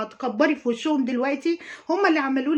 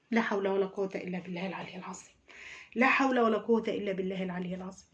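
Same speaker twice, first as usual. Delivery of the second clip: muttered prayer; 5 semitones lower, 8 dB quieter, faster than usual. Real, not acted.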